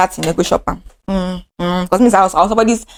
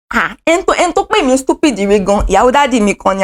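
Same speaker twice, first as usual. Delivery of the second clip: normal talking voice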